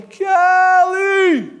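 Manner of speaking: high, loud drawn-out holler; far-carrying name call; distant calling out. Retold or imitated. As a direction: shout the name long and high. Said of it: "And I heard it again".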